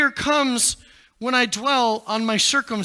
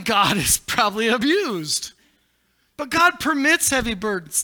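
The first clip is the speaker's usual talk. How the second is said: normal talking voice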